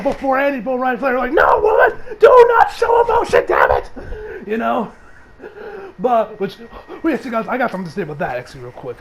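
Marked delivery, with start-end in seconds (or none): 1.3-3.9 yelling high and loud; angry shout. Retold or imitated. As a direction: bark it like a furious director. Director voiced yelling at actress.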